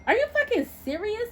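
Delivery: incredulous scoff; dry laugh. Acted. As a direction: scoff at the nerve of it. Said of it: "I asked with a dry laugh".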